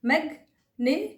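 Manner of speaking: "Magnificent" broken into mag, ni, fi, sent; syllable by syllable. Shown as a demonstration. Teacher showing every syllable, "magnificent" in separated pieces. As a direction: pause after each syllable of "magnificent". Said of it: "हर एक syllable".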